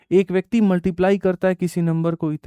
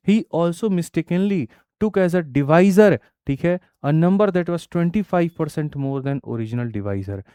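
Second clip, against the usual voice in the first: slow, deliberate reading of the question; measured classroom read-aloud; 4 semitones higher, 5 dB louder, much slower than usual. Acted. Reads the English question slowly.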